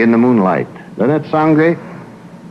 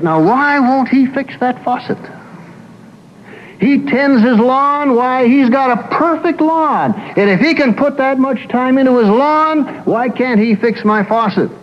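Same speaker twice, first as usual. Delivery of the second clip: higher, exasperated complaint about the husband; indignant wife; 10 semitones higher than usual. Retold or imitated. Wife complaining to the psychologist, exasperated indignation. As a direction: let the grievance build up high and aggrieved. Wife retells her mounting grievance.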